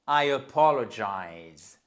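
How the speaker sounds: I apologize said slowly, each syllable clear; slow model phrase. Shown as a demonstration. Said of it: "c'était pour voir". (lent, articulé)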